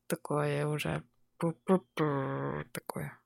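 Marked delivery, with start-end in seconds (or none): none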